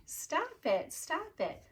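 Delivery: high-pitched, playful plea to stop; mock laughing plea. A narrator's reading. Teacher being tickled by Mister Tickle, mock laughing plea. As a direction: squeal it lightly as a tickled teacher. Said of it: "the teacher was laughing and saying".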